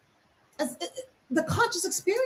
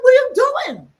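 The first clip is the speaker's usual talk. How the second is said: loud, high retold disbelief; retold outburst; 9 semitones higher, 8 dB louder than usual. Counsellor shocked at aide wiping a crying client's face, loud. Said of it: "I'm like".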